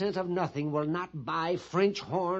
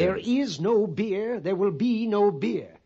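loud, insistent declaration stressing each "no beer"; emphatic refusal; 3 semitones higher, 5 dB louder than usual. Acted. Pliny refusing the captain a beer, emphatic refusal. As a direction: punch "is" and "be", loudly.

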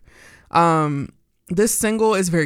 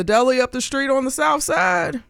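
hyped shout-out; 8 semitones higher than usual. Real, not acted. Shouting out the neighborhood.

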